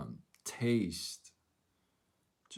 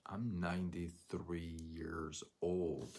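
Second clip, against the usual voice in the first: solemn slow; 5 dB quieter, slower than usual. Retold or imitated. Old man's solemn reply.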